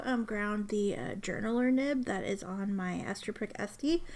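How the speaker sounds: normal talking voice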